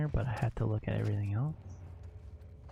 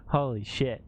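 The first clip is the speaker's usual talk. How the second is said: ordinary talk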